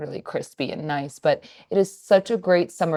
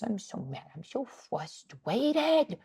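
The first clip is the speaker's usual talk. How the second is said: imitating toddler; 5 semitones higher, 9 dB quieter, much slower than usual. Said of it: "He goes".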